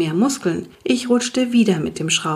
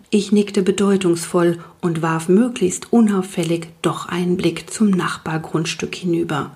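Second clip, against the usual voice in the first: usual speaking voice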